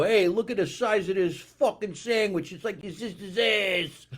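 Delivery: crude fan voice. Retold or imitated. Mocking the fans who comment on food videos. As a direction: ham up a crass internet viewer. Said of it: "They go".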